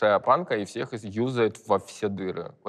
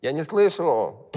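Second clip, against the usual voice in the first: drawn-out, higher-pitched imitation; higher, slow put-on call; mock can't-hear call; 6 semitones higher, slower than usual. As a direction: stretch it out like someone who can't hear. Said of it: "Говорит".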